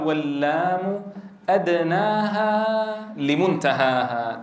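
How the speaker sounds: slow, chanted recital of the verse; verse recitation. Shown as a demonstration. Teacher reciting the poet's verse on lam to students. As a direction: recite the line slowly, drawing out each word. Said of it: "قال".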